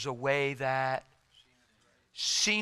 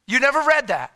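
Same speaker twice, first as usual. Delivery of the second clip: high, loud rhetorical jab; mock-incredulous shout; loud challenge; 8 semitones higher, 9 dB louder than usual. Real, not acted. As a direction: call it out to the crowd. Preacher exclaiming.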